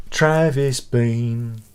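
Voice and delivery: "Travis Bean" stretched out very slowly; "Travis Bean" pronounced slowly, every syllable drawn long. Shown as a demonstration. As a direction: drawl out "Travis Bean" as if tasting the name. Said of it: "saying the name again slowly".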